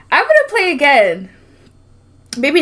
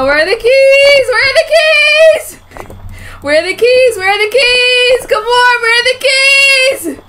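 frantic yelling; 11 semitones higher, 5 dB louder than usual. Real, not acted. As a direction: wail it over and over in panic. Frantic search for keys.

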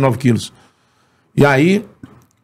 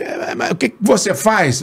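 higher put-on radio host voice; Antônio Carlos; 7 semitones higher than usual. Radio host asking the fan's job, higher imitated voice. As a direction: question in a lightly imitated host voice. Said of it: "Antônio Carlos".

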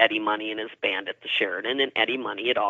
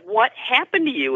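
high-pitched retold alarm; startled question; 12 semitones higher than usual. She asks her screaming girlfriend what happened, high alarm. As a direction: ask in mock alarm, voice jumping up.

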